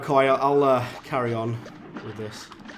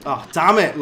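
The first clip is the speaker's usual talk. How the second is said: louder sudden curse at a mistake; frustrated curse; 3 semitones higher, 10 dB louder than usual. Real, not acted. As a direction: blurt it out as the hit lands. Takes a hit in game.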